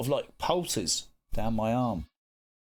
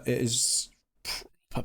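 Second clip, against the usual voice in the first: ordinary talk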